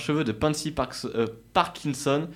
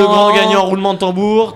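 loud, high winner reveal; hyped giveaway announcement; 6 semitones higher, 10 dB louder than usual. Acted. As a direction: build up the winner like a gameshow host.

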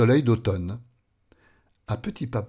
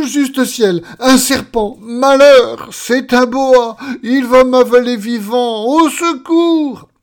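very loud, high screams for help; hysterical terror; 11 semitones higher, 12 dB louder, slower than usual. Acted. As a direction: scream as if about to be eaten. Coward screams at supposed snake. (hurlements de panique hystérique)

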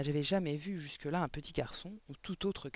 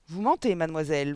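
louder, roaring accusation; bellowing; 9 dB louder than usual. Acted. Headmistress accusing the little girl, bellowed. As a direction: yell that she is lying. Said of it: "vociféra mademoiselle Le Gourdin".

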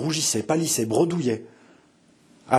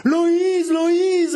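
much higher, loud ringing call; ringing cry; 5 dB louder than usual. A narrator's reading. Mother calls her daughter again, ringing and loud. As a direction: throw the name out with all her voice. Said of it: "d'une voix éclatante".

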